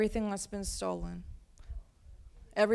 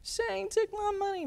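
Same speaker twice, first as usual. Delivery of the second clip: put-on wailing toddler; 12 semitones higher than usual. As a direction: cry it out like an upset little kid. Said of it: "And she said".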